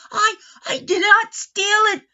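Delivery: high, loud, stammering protest. A narrator's reading. Old gentleman protests to the boys, high and frantic. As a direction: plead innocence in a panic. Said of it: "protested the old gentleman".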